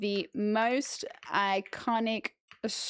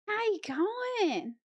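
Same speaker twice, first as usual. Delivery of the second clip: slow, high-pitched exaggerated Aussie greeting; mock Australian accent; 10 semitones higher, slower than usual. Streamer showing why she dislikes the accent, drawn-out mock Australian. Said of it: "I don't love hearing the Australian. It's just so, like".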